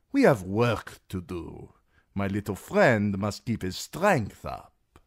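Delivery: a fat magister fussing over his guest; voice of Illyrio. A narrator's reading. Fat magister pressing food on his dwarf guest, indulgent. Illyrio urging Tyrion to eat.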